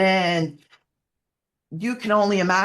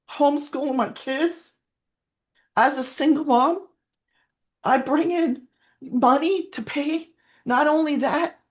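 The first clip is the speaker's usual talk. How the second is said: tearful; 6 semitones higher than usual. Real, not acted. A single mom telling how she provides now, tearful. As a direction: push through the tears with pride. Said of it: "I'm not gonna cry all the time".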